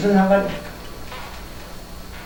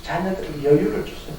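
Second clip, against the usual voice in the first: 여유를 said gently and much softer, the whole plea hushed; quiet pleading echo, 여유를 barely pressed; soft repeat; 3 semitones lower, 6 dB quieter than usual. Shown as a demonstration. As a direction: lower the voice and plead 여유를 tenderly.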